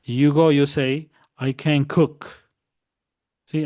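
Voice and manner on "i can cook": "I can cook" with the weight on "cook"; stress on cook. Shown as a demonstration. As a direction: lean on the verb "cook", keep "can" light. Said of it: "the emphasis of your voice, el énfasis de su voz, it's gonna be here on the verb".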